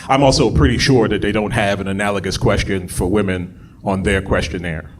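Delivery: normal talking voice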